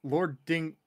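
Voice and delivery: normal talking voice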